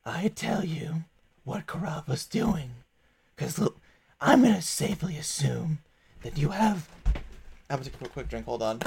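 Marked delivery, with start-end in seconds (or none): 0.1-2.7 confiding whisper. Acted. Devil confiding a bargain. 3.3-6.9 a silky, bargaining fiend, lightly voiced; a chained devil bargaining with the party, silky; smooth devil.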